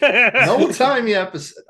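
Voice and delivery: put-on old-timey announcer. Announcing old-timey episode theme.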